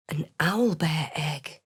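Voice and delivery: whispered, slow and low; hushed amazement at a rare find; awed whisper. Acted. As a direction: breathe it out in wonder. Awed discovery of egg.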